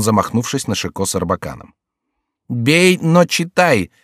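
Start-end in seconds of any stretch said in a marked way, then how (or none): 2.5-3.9 high, slow, drawn-out dare; defiant command. A narrator's reading. Jester daring the courtier to strike, defiant and drawn out. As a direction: stretch each word as a bold challenge.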